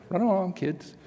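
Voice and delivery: high, mock-British dismissal; attempted British vicar. Vicar shooing the children from his table, attempted British accent. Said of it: "If I could do a British accent".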